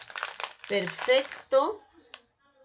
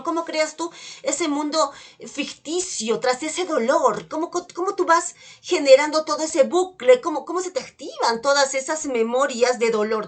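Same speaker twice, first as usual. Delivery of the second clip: louder, pressing guide voice; guided regression; 3 semitones higher, 6 dB louder than usual. Acted. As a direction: push her back into the painful memory. Intense guided regression exercise.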